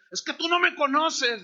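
high and loud, "you don't know me". Retold or imitated. Gunman protesting to the preacher, high and loud. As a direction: push back hard, voice up.

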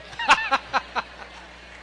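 airy, high chuckle; breathy laugh. Real, not acted. Pastor laughs at his joke about bail money, airy chuckle. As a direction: laugh at your own joke.

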